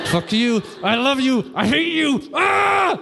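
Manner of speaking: climbing in pitch to a strangled cry; hammed-up fight bursting through the teeth. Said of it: "You do it through your teeth".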